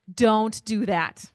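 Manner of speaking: put-on slow parent scolding a kid; scolding don't. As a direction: draw out a stern parental warning.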